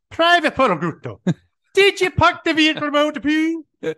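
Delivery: high, loud drill sergeant yell in a mock British accent; shouted British-accent impression; Staff Sergeant Raymond Taylor. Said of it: "you gotta do the accent".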